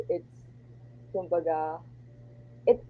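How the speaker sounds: usual speaking voice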